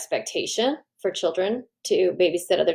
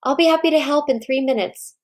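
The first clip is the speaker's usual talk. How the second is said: breezy parent line; 6 semitones higher than usual. Shown as a demonstration. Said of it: "you could say".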